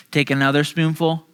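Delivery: ordinary talk, nothing marked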